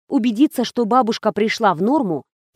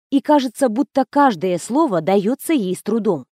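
usual speaking voice

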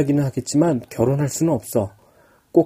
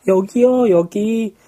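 raised calling voice; 8 semitones higher, much slower than usual. Acted. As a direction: call for help across the beach. Girl calls people over.

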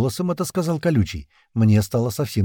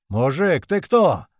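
challenging shout with a high jump; calling out; 5 semitones higher, much slower than usual. A narrator's reading. Туман challenges the robed man on the balcony, calling out. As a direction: call up to a stranger, pitch jumping. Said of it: "крикнул Туман".

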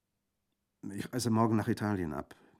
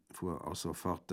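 usual speaking voice

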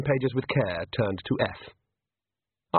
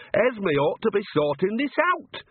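near wail; 10 semitones higher than usual. Acted. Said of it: "Nanny almost wailed".

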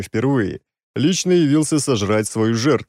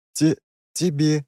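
stunned stammer; 5 semitones higher, slower than usual. A narrator's reading. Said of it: "всё ещё ошарашенно кивнула".

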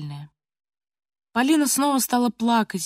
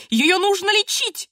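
very high, slow outcry; acted high, drawn-out shout; grandmother shouting; 10 semitones higher, 4 dB louder than usual. A narrator's reading. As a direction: wail the verdict as the grandmother. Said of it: "кричала бабушка".

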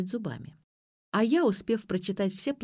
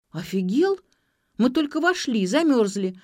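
higher-pitched, louder objection; indignant protest; 5 semitones higher, 5 dB louder than usual. A narrator's reading. Ksyu refusing to leave when just in, indignant protest. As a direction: protest with feeling. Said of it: "запротестовала Ксю".

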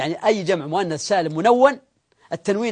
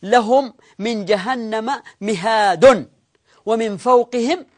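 very slow, drawn-out verse recitation; stretched, careful recital of a verse; Quran recitation; 2 semitones higher, much slower than usual. Acted. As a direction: recite with long vowels and deliberate pauses. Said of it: "في نحو قول الله سبحانه وتعالى".

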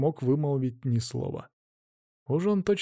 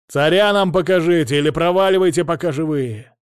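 loud, high sailor's yell; defiant shout; 4 semitones higher, 7 dB louder than usual. Acted. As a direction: hurl the threat back. Guardsmen shout defiance back. (дерзкий крик гвардейца)

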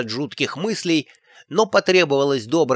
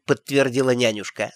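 usual speaking voice

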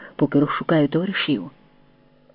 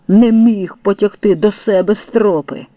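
raised-voice telling-off; scolding; 4 semitones higher, 5 dB louder than usual. A narrator's reading. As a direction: berate him, louder and higher. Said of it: "лаяв орла короб".

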